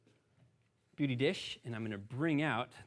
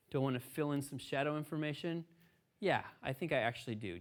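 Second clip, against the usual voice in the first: normal talking voice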